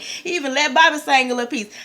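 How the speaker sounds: ordinary talk, nothing marked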